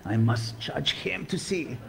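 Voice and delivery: slow, hushed, wary whisper; mock suspicious whisper from the pews. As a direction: whisper it like a doubting stranger. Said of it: "They're like".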